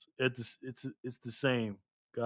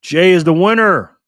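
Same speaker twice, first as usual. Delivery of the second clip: loud, slow, raised-pitch proclamation; winner announcement; 5 semitones higher, 13 dB louder, slower than usual. Real, not acted. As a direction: belt out the result, drawing it out.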